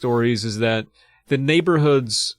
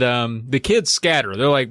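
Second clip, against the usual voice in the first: ordinary talk, nothing marked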